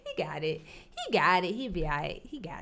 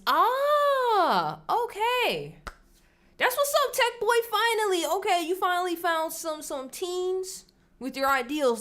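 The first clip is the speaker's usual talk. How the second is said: much higher, playful mocking tone; mock teasing voice; 10 semitones higher than usual. Wife teasing husband about his new conservative friends, high and mocking. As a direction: tease him in a high sing-song.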